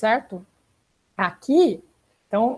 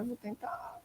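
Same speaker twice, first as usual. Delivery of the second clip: whisper; 20 dB quieter, slower than usual. Real, not acted. Aside to student Maria Clara.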